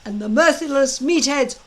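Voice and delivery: loud, high, gloating drill-master voice; a big blustering Viking instructor gloating at his recruits; booming Gobber. Acted. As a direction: lord it over the boys with relish. Gobber's speech to the boys.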